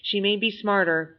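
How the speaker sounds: ordinary delivery, nothing marked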